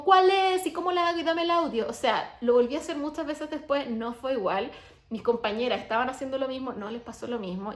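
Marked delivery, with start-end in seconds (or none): 0.0-1.9 much higher, louder pushy questions; mock eager listener. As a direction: parody impatient fans demanding the technique. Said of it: "no me digan como".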